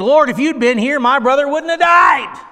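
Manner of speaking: loud, high-pitched accusation; accusing. Acted. Mary accuses Jesus at his feet, loud and accusing. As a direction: cry out in reproach, building to the last word. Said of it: "Now she's gonna accuse him".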